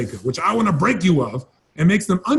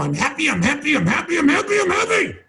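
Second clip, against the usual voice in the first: manic chant climbing higher and higher; mock a frantic mirror affirmation, pitch rising each time; mad tone; 9 semitones higher than usual. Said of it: "mad look on your face and mad tone".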